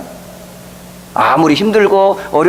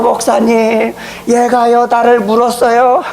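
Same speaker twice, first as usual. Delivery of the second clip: put-on higher, childlike whiny complaint; tattling little girl; 5 semitones higher, slower than usual. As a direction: play the hurt girl telling on him.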